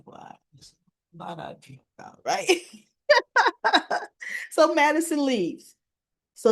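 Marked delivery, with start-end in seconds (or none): 0.1-2.2 acted reply, barely audible, nearly voiceless; hushed mock disbelief. Bill reacting to what Madison tells him, hushed. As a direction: let it fall to a faint whisper. Acting out Bill's stunned reply.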